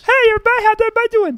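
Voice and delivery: squeaky falsetto mockery; high squeaky mock voice; impression of Dan. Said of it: "That's not what I sound like".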